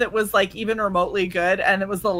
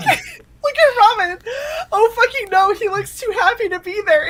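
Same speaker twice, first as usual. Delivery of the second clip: appalled squeal; 13 semitones higher than usual. Real, not acted. Host appalled by a grinning doctor photo, high squeal. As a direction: shriek in horror.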